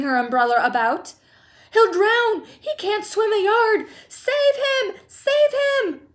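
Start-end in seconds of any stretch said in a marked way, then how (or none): 1.7-6.1 very high, loud panic; screamed. A narrator's reading. Mother begging rescue for drowning son, screaming. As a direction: shriek desperately for rescue. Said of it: "screamed Missus Gloop".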